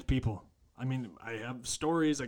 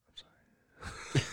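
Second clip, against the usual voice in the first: whispered sorry; much slower than usual. Real, not acted. Apologising to the person listening.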